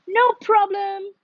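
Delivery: high, bright call out; a small frog calling out, lightly shouted; eager shout. A narrator's reading. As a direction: shout it up to the stars. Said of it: "shouted Very Small Frog".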